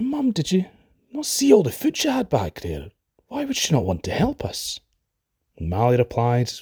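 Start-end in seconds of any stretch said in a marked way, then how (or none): 0.0-4.8 put-on high, pleading little boy; a wee lad, bewildered and hurt; young boy voice. Hungry boy asks mother.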